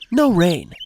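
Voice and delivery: ordinary talk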